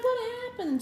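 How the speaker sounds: very high imitation of a partner; imitated concerned wife. Wife hearing of the bad morning, imitated high concern. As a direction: voice the wife's worried question up high. Wife's concerned reply, retold.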